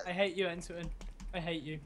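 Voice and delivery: very soft, low hurt confession; a hint of a jilted lover, hushed; mock heartbroken murmur. Mock breakup, said very softly.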